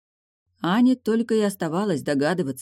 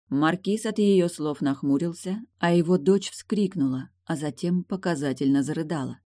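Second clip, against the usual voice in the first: ordinary delivery, nothing marked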